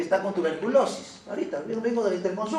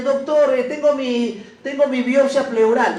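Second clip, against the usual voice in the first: higher, louder eager patient voice; imagined patient; 4 semitones higher, 5 dB louder than usual. Pretend. Teacher voicing a patient proudly presenting a test, eager and raised. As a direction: play the patient showing off his test.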